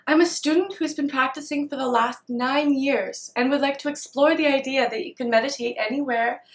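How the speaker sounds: ordinary talk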